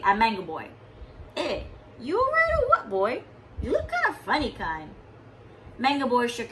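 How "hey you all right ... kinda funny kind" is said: high, lightly voiced pidgin friend; concerned old friend in pidgin; friendly vendor. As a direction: check on the boy kindly. Manapua man checks on boy.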